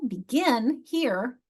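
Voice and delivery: "Begin" slowly, stretching GIN; slow demo be-GIN for "Begin"; second-syllable stress. Shown as a demonstration. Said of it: "Where's the strong syllable?".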